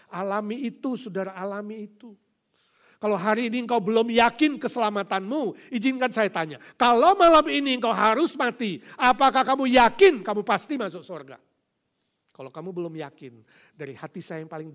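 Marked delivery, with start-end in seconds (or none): 3.0-11.4 loud, high preaching climax; rising fiery sermon; preaching at full force. Acted. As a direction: thunder it from the pulpit. Altar call, loud.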